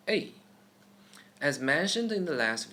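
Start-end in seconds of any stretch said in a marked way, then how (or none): 0.1-0.3 A falling sharply, fourth tone. Shown as a demonstration. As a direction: snap A down.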